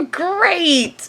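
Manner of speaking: nervous laugh into a high, strained "great"; forced cheer. Retold or imitated. Guest answering creepy host, forced nervous cheer. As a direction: fake being fine with it, pitch climbing. Forced reply to alarming excuse.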